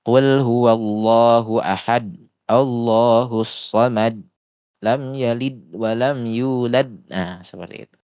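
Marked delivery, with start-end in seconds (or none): 0.0-6.9 slow melodic Quran recitation; slow tajwid recitation. Shown as a demonstration. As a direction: chant it slowly like tilawah. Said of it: "Contohnya misalkan, Al-Ikhlas".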